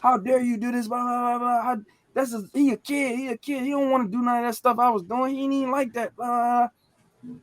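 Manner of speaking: high-pitched indignant scolding; imitated tirade. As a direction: act out an uncle going ham on his mother. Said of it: "went ham on her".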